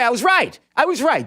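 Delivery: loud, high gloating boast; triumphant. Real, not acted. To cohost Jake, crowing that he was right, loud and gleeful. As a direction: crow it out, delighted with yourself.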